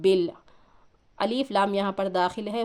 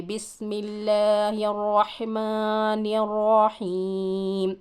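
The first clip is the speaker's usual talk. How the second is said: slow, drawn-out tajweed chant; Quranic recitation; much slower than usual. Shown as a demonstration. Teacher recites slowly to the student. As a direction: recite it slowly, stretching the long vowels.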